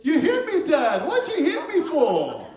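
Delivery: put-on high, loud, outraged kid's scream; screaming child. Son accuses dad in Kmart, screaming high and loud. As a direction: yell it as an accusing son, stretching the last words. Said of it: "He starts screaming".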